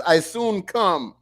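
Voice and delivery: slow Jamaican patois drawl; Jamaican accent. Pretend. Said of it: "He said in my Jamaican voice".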